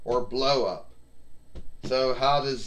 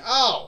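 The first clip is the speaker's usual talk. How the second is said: sudden high yelp, louder; high excited shout; 12 semitones higher, 7 dB louder than usual. Pretend. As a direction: cry out at the big hit. A big hit lands in the match.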